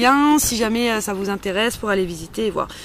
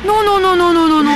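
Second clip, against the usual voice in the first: loud, high, playful refusal; emphatic no; 7 semitones higher, 6 dB louder than usual. Real, not acted. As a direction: shut the idea down with a loud, laughing no. Rejecting her own pretend request. (non catégorique, fort et enjoué)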